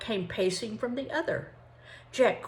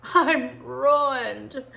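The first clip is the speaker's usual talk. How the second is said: higher, louder wail of despair; 6 semitones higher, 8 dB louder than usual. Acted. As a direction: wail it like a ruined man. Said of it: "he wailed".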